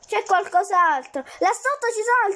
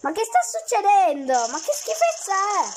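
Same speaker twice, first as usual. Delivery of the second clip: normal talking voice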